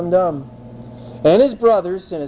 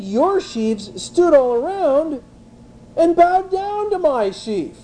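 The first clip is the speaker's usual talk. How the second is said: put-on eager, high-pitched bragging teen voice; boastful young Joseph; 8 semitones higher than usual. Preacher voicing Joseph bragging to his brothers, eager and high. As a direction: gush about the dream like a showoff kid.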